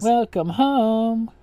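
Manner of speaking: slow, drawn-out host sign-off; lightly imitating the host's welcome; catchphrase imitation. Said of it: "as Juan Thula says".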